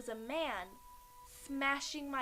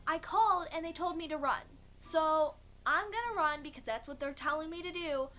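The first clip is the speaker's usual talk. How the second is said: high, raised, fed-up voice; exasperated calling out; 5 semitones higher, 4 dB louder than usual. Retold or imitated. Wife calling to unseen husband that she's leaving, exasperated. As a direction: announce it loudly, out of patience.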